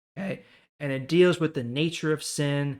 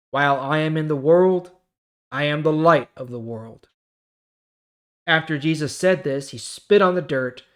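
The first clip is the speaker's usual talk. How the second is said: louder, clear Bible reading voice; scripture reading; 3 semitones higher, 8 dB louder than usual. Acted. YouTuber reading John chapter nine to his viewers, projected scripture reading. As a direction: read the chapter out like a preacher.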